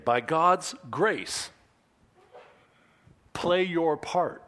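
slow stress on GODS and PLAY; slow and deliberate. Shown as a demonstration. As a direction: spell out the sermon point slowly. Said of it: "write this one down".

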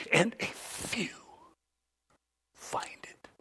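whispered dramatic close. Real, not acted. Preacher ending Jesus' quote, whispered. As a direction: drop to a whisper for a few. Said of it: "reading Jesus' words".

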